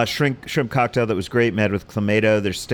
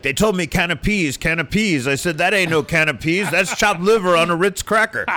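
higher-pitched movie mobster imitation; Godfather character; 8 semitones higher than usual. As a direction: act out an indignant movie line, pitched up. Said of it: "that scene in the beginning of The Godfather".

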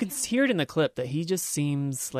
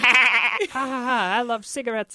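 a lightly done cackling laugh into a line, louder at the start; 6 semitones higher, 3 dB louder, slower than usual. Retold or imitated. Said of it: "She's cackling like".

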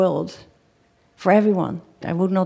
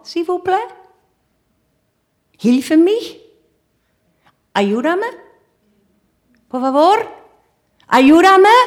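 higher and louder, pleading in every language, building to a shout; desperate calls for help; 8 semitones higher, 5 dB louder than usual. Retold or imitated. Survivor calling out to a silent stranger for help, building to a shout. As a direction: beg the stranger for help, rising to a cry.

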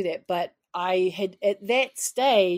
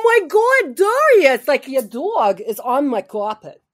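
loud, high, hammed-up New York accent; 6 semitones higher, 12 dB louder, slower than usual. Shown as a demonstration. Actress complains to Darius about his dog, loud New York accent. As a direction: holler it like a brash New Yorker. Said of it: "In New York".

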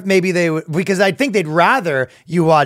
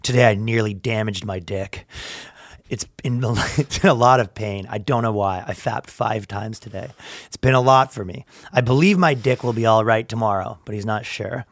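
low, soft confessional read; mock-solemn post reading; 6 semitones lower, 5 dB quieter than usual. Reading a stranger's confession to the co-host, low and solemn. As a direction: read it out gravely, voice dropped. Reads a sad online post.

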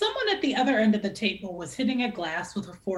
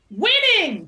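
high, loud triumphant shout; 10 semitones higher, 8 dB louder than usual. A narrator's reading. Wynn shouting during Ron's toast, loud and high. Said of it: "shouted Wynn".

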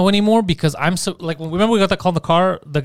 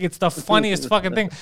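usual speaking voice

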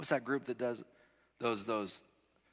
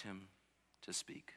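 whispered ending; 2 semitones lower, 14 dB quieter, slower than usual. Real, not acted. Hushed end of point.

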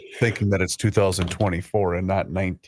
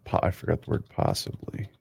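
muttering to self; 5 semitones lower, 6 dB quieter than usual. Real, not acted. Muttering while typing.

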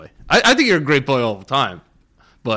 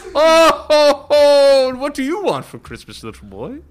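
put-on jolly mall Santa talking to a kid; hammed-up Christmas helper voice. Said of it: "How do I sound like an elf?".

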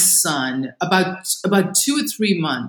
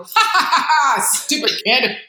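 high, hammed-up scoffing cackle; mocking laugh; 6 semitones higher, much slower than usual. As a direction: laugh it off as a silly idea. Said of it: "every night I'd go".

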